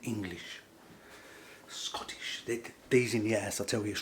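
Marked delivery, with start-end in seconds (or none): 1.7-2.4 hushed whisper. Real, not acted. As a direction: breathe the word out. Whispered word again.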